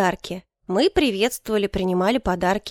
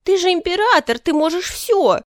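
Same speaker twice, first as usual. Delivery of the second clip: whiny protest; 8 semitones higher, 4 dB louder than usual. A narrator's reading. Favourite insists to the emperor, whiny protest. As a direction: wail it up high and louder.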